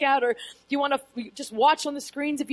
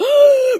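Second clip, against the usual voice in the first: loud high gasp of shock; sudden loud startled gasp; sharp gasp; 10 semitones higher, 12 dB louder than usual. Retold or imitated. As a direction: gasp out loud as if seeing the risen Jesus. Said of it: "And she's like".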